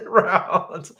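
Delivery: usual speaking voice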